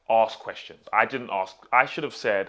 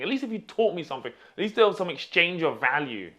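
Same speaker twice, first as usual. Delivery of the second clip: raised, high-pitched grievance; indignant complaint; 5 semitones higher, 6 dB louder than usual. Real, not acted. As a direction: protest the unfair deal, pushing each point. Complaining to employer about unpaid trial.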